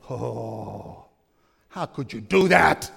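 hushed gasp building to indignation; mock outrage. Churchgoers judging the servant, mock-appalled reproach. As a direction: play the scandalized onlooker. Acting out shocked listeners' reaction.

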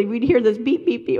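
ordinary talk

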